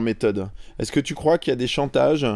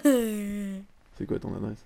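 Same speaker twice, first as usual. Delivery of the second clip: high, very slow questioning officer; police adjudant-chef; 9 semitones higher, much slower than usual. Pretend. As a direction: drag out the question like a cop. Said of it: "je fais l'adjudant-chef Robert".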